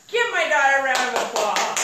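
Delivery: loud, high announcer-style shout; rally the crowd to clap; calling for applause. Real, not acted. Mother asking the audience to applaud her daughter, loud and high.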